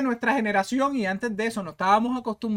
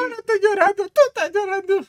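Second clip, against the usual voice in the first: high, louder teasing singsong; mock denial; 11 semitones higher, 6 dB louder, faster than usual. Co-host teasing the crybaby gamer, mock denial. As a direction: tease him back with playful denial.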